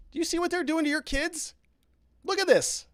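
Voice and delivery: alarmed, pointing out the danger; urgent alarm. Retold or imitated. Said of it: "and I go".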